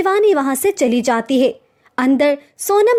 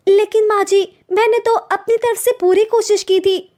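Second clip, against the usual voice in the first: put-on young wife pleading; 7 semitones higher than usual. Daughter-in-law pleads with mother-in-law over the food, higher meek voice. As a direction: defend your effort meekly to the mother-in-law.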